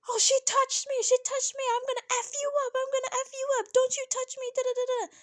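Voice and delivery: very high-pitched, hammed-up outrage; mock hysterical rant. Reviewer mimics Jen's outburst after the push, mock hysterical. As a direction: squeal out a mocking imitation of an outburst. Mocking retold outburst by Jen.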